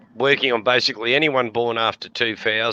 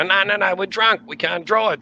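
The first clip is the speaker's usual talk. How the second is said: tag only; 9 semitones higher than usual. Retold or imitated. Drunk pleading with cops.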